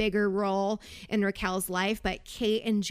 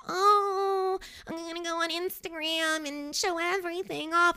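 high, sing-song mock show-off bride; mocking gush; 6 semitones higher than usual. Host mocks brides showing off on Instagram, high mock gushing.

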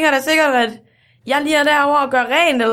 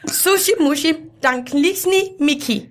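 mock Japanese; 4 semitones higher, slower than usual. Retelling a manga story for mum to translate, mock Japanese. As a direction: pretend Japanese, slowing on the second phrase. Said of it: "Hun tager den på japansk".

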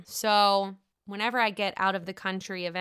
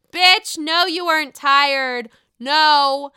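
much louder, emphatic no; loud retort; 5 semitones higher, 12 dB louder, slower than usual. Real, not acted. Host shouting down Leah's excuse to listeners, loud. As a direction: call out the lie loudly.

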